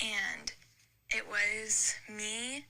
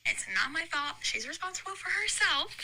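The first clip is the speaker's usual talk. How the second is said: retold indignant pushback, pitched higher; defensive protest; 6 semitones higher than usual. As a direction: protest the blame in a raised pitch. Retelling her protest at being blamed.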